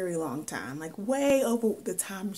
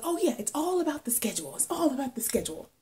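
quick, higher-pitched mock-breezy reply; breezy boast; 7 semitones higher, faster than usual. Student answering people amazed at all her clubs, breezy. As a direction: toss it off fast and high, as if it's easy.

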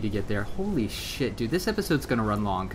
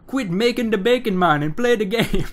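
mock Jamaican accent; 10 semitones higher, 6 dB louder than usual. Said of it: "it sounds like bacon in Jamaican accent".

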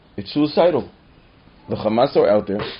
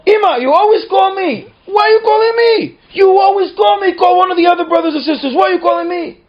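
put-on much higher, louder child's shouting; whiny yell; 9 dB louder than usual. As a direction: shout like a put-upon kid.

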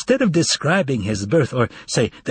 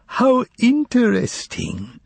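high, slow foreign exclamation; exclaiming; 11 semitones higher, slower than usual. Acted. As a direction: exclaim it with drawn-out delight. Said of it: "exclaimed the foreigner".

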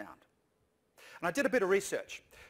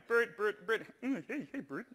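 lightly voiced Ernie, higher and laughing; 4 semitones higher than usual. Speaker recalls the Ernie impression, a laughing half-hearted Ernie. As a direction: call Bert through a giggle. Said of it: "doing Bert and Ernie".

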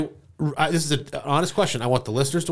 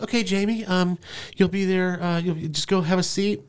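higher-pitched, offhand receptionist; lightly mocking receptionist voice; imitated receptionist; 6 semitones higher than usual. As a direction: play a front-desk worker, pitched up and hesitant. Acting out the receptionist.

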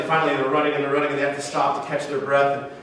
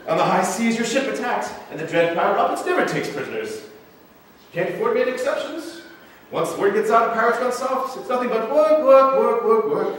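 lightly acted pirate, pitched up; hammed-up complaint in a higher voice; mock pirate bluster; 8 semitones higher, 3 dB louder than usual. Masked pirate excusing his ruthlessness, mock bluster.

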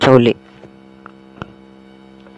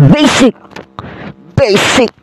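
gloating cheer; 10 semitones higher, slower than usual. Real, not acted. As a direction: crow about the easy win. Gloating after win. (mayabang na sigaw)

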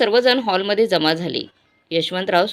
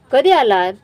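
narrated angry father, raised voice; loud demand; 5 semitones higher, 6 dB louder than usual. A narrator's reading. Father challenging his visiting sons, loud demand. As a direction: demand it sharply, louder and higher. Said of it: "Yashwantrao जोरातच ओरडले".

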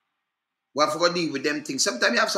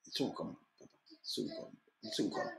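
trailing off into a whisper; fading whisper; 6 semitones lower, 17 dB quieter than usual. Real, not acted. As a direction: let it fade to a whisper. Repeated, fading away.

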